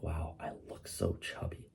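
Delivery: whispered and slow, shocked at her reflection; dismayed whisper. Retold or imitated. Watching her own reel back, a dismayed whisper. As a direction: breathe it out in disbelief. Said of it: "I was like".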